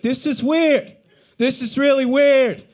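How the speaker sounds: high, loud self-conscious outburst; loud awkward blurt. Retold or imitated. Walking out of the store with the canvas, loud high blurt. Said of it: "out loud was like".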